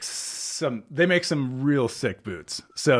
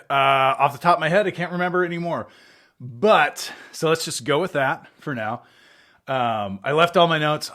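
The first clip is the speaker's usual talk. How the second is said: ordinary talk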